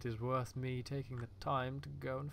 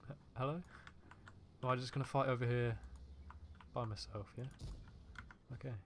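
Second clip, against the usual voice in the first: ordinary delivery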